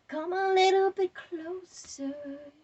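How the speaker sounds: slow, loud, high taunt; taunting the devil. Acted. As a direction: goad the devil, stretching every word. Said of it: "what I say to the devil".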